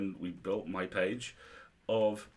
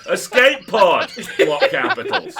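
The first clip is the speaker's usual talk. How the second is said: loud spelled-out capitals; 8 semitones higher, 16 dB louder, slower than usual. Retold or imitated. Reading out a spam email.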